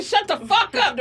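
high-pitched exasperated mock order; imitating irritated officer. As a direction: play a cop fed up with the crying. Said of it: "officer was so irritated with me".

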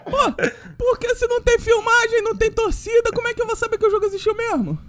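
high, rushing protest; worked-up incredulous question; comic exasperation. Pretend. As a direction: argue in disbelief on a high sustained pitch. Comic protest about missing footage.

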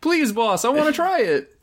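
very high whiny begging; high pleading voice. Acted. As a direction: beg in a squeaky high voice. Pleading comic character.

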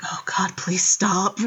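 a touch of a tired, pleading dad; weary dad. Retold or imitated. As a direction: sigh it out like a worn-out father. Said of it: "the dad's just like".

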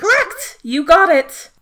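high, loud burst of confirmation; triumphant quizmaster. Acted. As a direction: announce the winning answer in a delighted squeal. Quizmaster confirms right answer.

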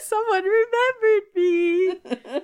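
high, slow, tearful squeak; hammed-up sob of gratitude; a weepy android, overjoyed to be noticed; mock tearful Vision. Acted Vision quote, crying voice.

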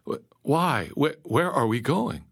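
high, stammered questions; alarmed exclamation. A narrator's reading. Captive asking his kidnapper the destination, alarmed. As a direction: stumble over anxious questions. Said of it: "I exclaimed".

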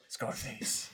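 breathy whispered repeat of the name; hushed repeat. Real, not acted. Whispering his regretted costume to friend. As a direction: whisper the name again, rueful.

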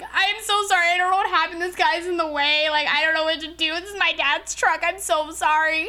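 high, loud, put-on tearful pleading; mock crying. Driver pleading with a cop over the dented door, mock crying. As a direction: turn on the waterworks and wail an apology. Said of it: "I'm, like, crying".

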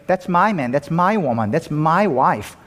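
"my" lifted high in each phrase; stress on MY. Shown as a demonstration. Showing what calling somebody my means, stress on "my". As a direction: lean possessively on "my" every time. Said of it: "When you call somebody my".

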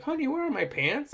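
high silly husband whine; dumb husband voice. Retold or imitated. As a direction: play the dopey guy yelling for his wife. Dumb sitcom husband calling out.

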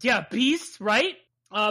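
ordinary talk

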